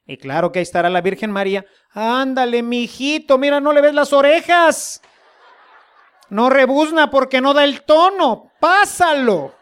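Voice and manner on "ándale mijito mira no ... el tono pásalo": higher, laughing, coaxing motherly voice; coaxing Virgin Mary. Pretend. The Virgin Mary urging the Lord to pass him, laughing. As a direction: nudge the judge with a chuckle, higher.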